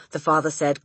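usual speaking voice

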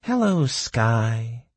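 slow, high, dreamy greeting; lost in a daydream; dreamy musing. A narrator's reading. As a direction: draw it out dreamily, pitch lifted. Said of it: "dreamily mused Orbit".

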